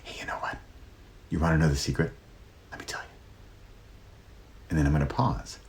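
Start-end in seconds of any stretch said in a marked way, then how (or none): 0.0-3.1 soft secretive whisper; breathy conspiratorial whisper, much softer. Shown as a demonstration. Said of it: "I can whisper something".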